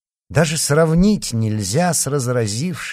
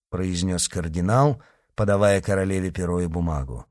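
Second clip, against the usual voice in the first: usual speaking voice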